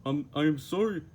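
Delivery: a loud, high Patrick doing his best. Acted. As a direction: play Patrick, sorry and sweet. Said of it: "said Patrick".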